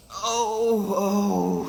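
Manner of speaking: loud, high wail of grief; hammed-up sobbing "oh"; mock crying wail. A narrator's reading. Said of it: "he cried".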